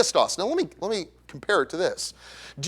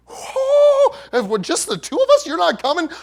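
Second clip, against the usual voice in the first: panicked disciple; 12 semitones higher, 6 dB louder than usual. Retold or imitated. Fishermen panicking at Jesus' mission.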